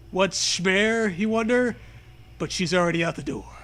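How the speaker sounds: ordinary talk, nothing marked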